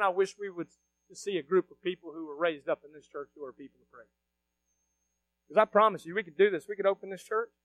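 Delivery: normal talking voice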